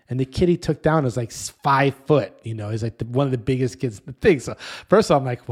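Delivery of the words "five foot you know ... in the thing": ordinary delivery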